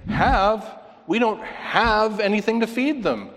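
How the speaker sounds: high, incredulous echo of "Have?"; throwing HAVE back. Acted. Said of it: "they throw his have back at them".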